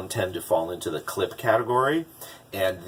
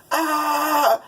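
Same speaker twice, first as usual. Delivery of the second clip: high, loud imitated alarm shriek; mock alarm scream; 6 dB louder than usual. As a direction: let out a cartoon "time's up" scream. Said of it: "and it goes".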